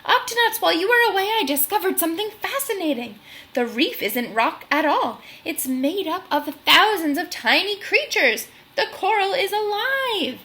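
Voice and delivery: high, eager burst of discovery; excited exclaiming. Acted. Otter telling the crew his discovery, high excited exclaim. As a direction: bubble over with the news.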